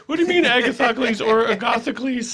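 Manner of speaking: high, loud squeaky voice of an offended fan; mock indignant fan. A bandmate squeaks like a fan offended by his taste in music. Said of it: "mock fan voice".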